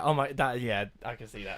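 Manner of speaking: ordinary delivery, nothing marked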